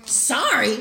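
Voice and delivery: loud impression with a pitch leap; Soldier Boy. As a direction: throw it out as a punchy catchphrase. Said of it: "in the Soldier Boy voice".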